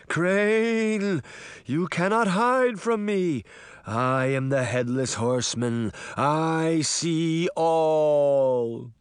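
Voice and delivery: slow, wailing phantom voice; haunt him with long drawn-out words; ghostly Headless Horseman. A narrator's reading. Sabrina scaring Crane as the Horseman, ghostly wail.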